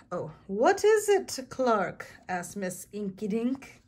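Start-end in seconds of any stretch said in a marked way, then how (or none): 0.1-2.1 high, loud "what is it" falling to the name; acted, animated question; eager asking. A narrator's reading. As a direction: ask with big storybook curiosity, pitched way up. Teacher asks Clark, redone bigger.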